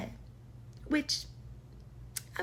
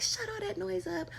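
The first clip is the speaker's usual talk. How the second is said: mock scolding cousin; 11 semitones higher than usual. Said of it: "my cousin would be like".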